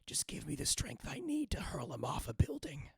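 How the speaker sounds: eerie ghostly whisper. Acted. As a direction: breathe it like a vengeful spirit.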